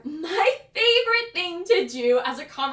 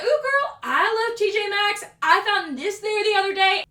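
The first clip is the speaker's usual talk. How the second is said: put-on high, loud girlfriend gushing; acted squeal of shared enthusiasm; 6 semitones higher, 4 dB louder than usual. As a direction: bond excitedly over a favorite store. Acted reply to customer.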